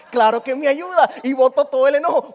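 husband cheerfully admits his revenge, loud; punchline said loud. Acted. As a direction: deliver it with relish, loud. Husband venting anger.

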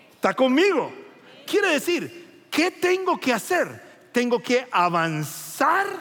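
slow, high, loud preacher's drive; fervent preaching. Acted. Preacher urging the congregation to advance, fervent. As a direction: push the church forward.

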